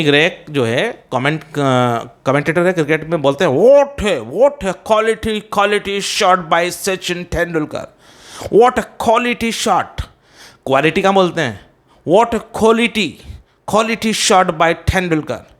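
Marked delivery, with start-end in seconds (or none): 3.5-10.0 high, drawn-out cricket commentator exclaiming; Tony Greig commentary. Retold or imitated. Teacher imitating a commentator praising a shot, slow high exclamation. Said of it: "कि Tony Greig commentator हैं". 12.1-15.5 slow, high cricket commentary; Tony Greig commentary. Retold or imitated. Teacher imitating a commentator on Tendulkar's shot, slow exclamation. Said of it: "कि Tony Greig commentator हैं".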